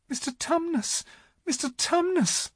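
put-on very high, anxious little girl; great distress. A narrator's reading. Lucy calling to the howling faun, in great distress. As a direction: plead in a small, high voice. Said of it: "said Lucy in great distress".

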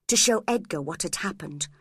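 ordinary delivery, nothing marked

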